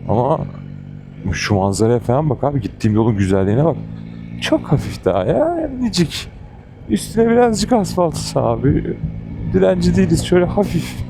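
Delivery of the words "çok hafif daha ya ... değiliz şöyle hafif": exasperated plea. Real, not acted. Pleading for a little asphalt.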